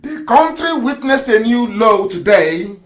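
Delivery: slow, deliberate mock reading; read very slowly. Shown as a demonstration. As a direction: spell it out slowly as if for a slow listener. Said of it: "I'll read very slowly so you understand it".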